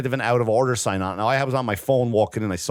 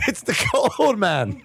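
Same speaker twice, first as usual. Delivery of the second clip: much higher, laughing through the complaint; high laughing outrage; 13 semitones higher than usual. Retold or imitated. Retelling the bathroom clash to his friend, high laughing outrage. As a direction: scold him while cracking up. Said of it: "I'm like".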